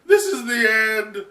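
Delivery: high crying wail, then slow despair; panicked wail. Acted. Momo tumbling from the sky, crying out in despair. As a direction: wail as you fall. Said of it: "He's like".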